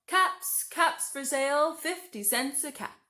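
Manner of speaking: high, loud street cry; calling out wares. A narrator's reading. Peddler calling to buyers, loud street cry. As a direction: sing out to the whole street. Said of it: "he called".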